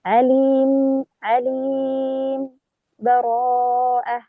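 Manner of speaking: a light sakt before براءة; brief pause without breath. Shown as a demonstration. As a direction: stop briefly on عليم with no breath, then براءة. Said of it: "هنا وقفت ما بين عليم وبراءة لكن بدون أخذ نفس".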